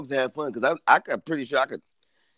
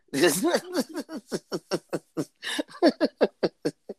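high chuckling that trails on; real laughing fit; 5 semitones higher, 4 dB quieter than usual. Real, not acted. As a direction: laugh at your own joke. Cracking up at banter.

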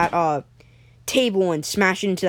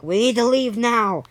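higher, drawn-out call to leave; urgent call; 5 semitones higher than usual. Acted. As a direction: call out slowly across a ship's deck. Captains call for departure.

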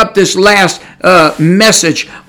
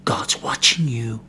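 breathy hushed warning, slow; whispered warning that God sees; 10 semitones lower, 12 dB quieter, slower than usual. Acted. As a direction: lean in and whisper it like a secret threat. Preacher whispers warning to congregation.

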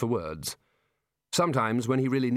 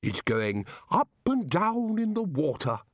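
higher, slow, sing-song dottiness; addled bursar; 6 semitones higher than usual. Acted. Muddled bursar watching his boat, high and dreamy. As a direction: let it bob slowly up and down.

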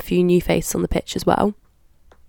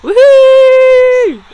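cheer; 9 dB louder than usual. Real, not acted. Cheering after anthem.

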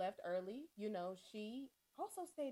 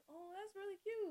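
high, bright-eyed gush; genuine cute voice; 10 semitones higher, 3 dB quieter than usual. Retold or imitated. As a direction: gush over it sweetly. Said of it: "that's literally my voice when I".

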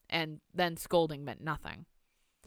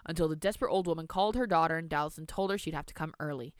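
ordinary delivery

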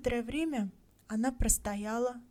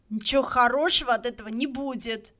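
firm; 3 semitones higher, 8 dB louder than usual. A narrator's reading. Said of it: "твёрдо сказал старик Уорнер".

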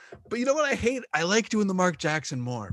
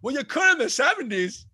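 high, loud indignant comeback; badly imitated sportscaster, voice pitched way up; terrible Jeff Van Gundy; 10 semitones higher, 5 dB louder than usual. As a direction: protest loudly in a high mock-commentator voice. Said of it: "That is a terrible Jeff Van Gundy".

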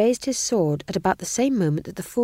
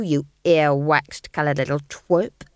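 sneering guard voice; 4 semitones lower, 3 dB louder than usual. Acted. Guard insulting the fool.

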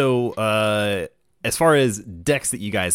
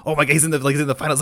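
high-pitched excited retelling; freaking out; 5 semitones higher, much faster than usual. Retold or imitated. Friends hearing he's in the finals, freaking out. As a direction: re-enact breathless fan disbelief, pitched up. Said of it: "just, like, freaking out".

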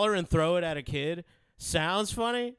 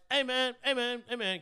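higher, calling hey man; kid calling out; 7 semitones higher than usual. Retold or imitated. Teller imitating the kid calling to his car, higher. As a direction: call out like a pushy kid.

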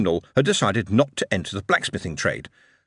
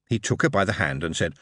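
ordinary delivery

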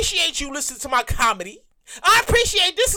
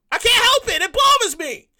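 ordinary talk

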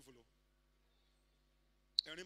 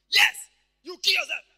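high, loud vindictive shout; explosive acted anger; angry devil; 8 semitones higher, 22 dB louder than usual. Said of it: "he will be angry".

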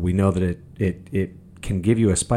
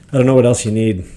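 loud, exasperated shout at the viewers; fed-up yell at the audience; angry outburst; 3 semitones higher, 10 dB louder, faster than usual. Real, not acted. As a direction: let it burst out in disgust. Angry rant at CNN watchers.

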